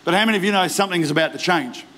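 ordinary delivery

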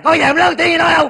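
high, loud protest of innocence; shouted self-defence. Acted. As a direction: shout that you never shout. Denies being loud while shouting. (gào lên phân bua)

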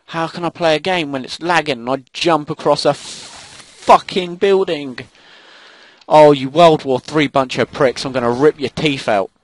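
angry rant. Real, not acted. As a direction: rant in real exasperation at the game. Ranting at lag and enemies.

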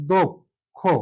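দো with a closed o, pause, then খো; syllable-by-syllable demo. Shown as a demonstration. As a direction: break it as "do... kho", both vowels rounded o. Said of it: "অর্থাৎ ও হয়ে যাবে". (অ-কে ও করে ভেঙে বলা "দো... খো")

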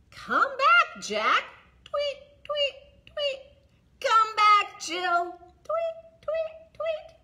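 very high chirping tweet, tweet, tweet; high bird tweets. Shown as a demonstration. Librarian makes the blackbirds chirp home for the toddlers, very high. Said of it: "Can we make these be our blackbirds?".

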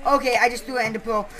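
usual speaking voice

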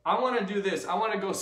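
usual speaking voice

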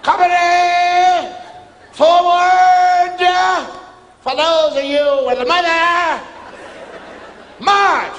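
high, loud, slow drawn-out commands; drill command. Retold or imitated. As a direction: call the company to move out.

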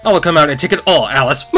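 loud gloating shadow vow; triumphant villain. Acted.

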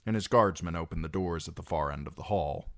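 ordinary delivery, nothing marked

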